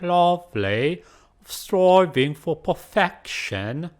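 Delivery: high, loud, slow posh British sales voice; hammed-up British accent. Mocking the coffee's marketing blurb in a hammed-up British accent. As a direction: oversell it like a grand British narrator. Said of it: "Should be talking British, innit?".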